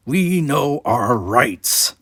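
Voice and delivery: slow, loud and heated; hot. Acted. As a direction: lay claim to every word with heat. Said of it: "was saying hotly".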